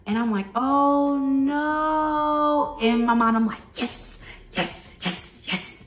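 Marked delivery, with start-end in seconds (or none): 0.6-2.8 high, drawn-out fake dismay; syrupy mock-sorry coo; feigned sympathy. Fake sympathy hiding relief. 3.7-5.8 whispered gleeful cheer; breathy, voiceless private triumph; secret celebration. Retold or imitated. Secret relief at postponed date.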